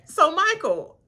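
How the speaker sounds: high, teasing interrogator voice; teasing imitation of the castmates; mock interrogation. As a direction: play the nosy questioner, high and laughing. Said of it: "They just said".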